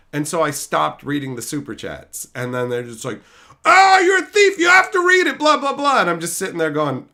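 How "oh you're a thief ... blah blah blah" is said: high, loud whining outburst imitating trolls; mock yelling. Streamer mimics trolls demanding he read super chats, mock yelling. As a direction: yell it as an exaggerated imitation of angry trolls. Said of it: "yelling at me in all caps".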